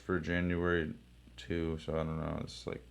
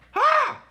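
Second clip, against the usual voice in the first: a sudden very high, loud single laugh bark; one triumphant high ha; sharp high mock laugh; 13 dB louder than usual. Reacting to the comic panel with a very high loud laugh.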